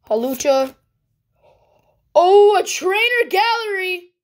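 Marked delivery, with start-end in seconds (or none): 2.1-4.1 higher, delighted exclamation; pleasantly surprised, pitched up; excited discovery. Real, not acted. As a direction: react to a lucky pull. Pulls a good card.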